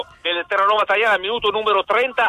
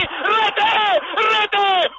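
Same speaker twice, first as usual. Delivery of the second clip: high excited goal call; yelling rete for the goal; goal shout; 9 semitones higher than usual. Real, not acted. Goal called on radio.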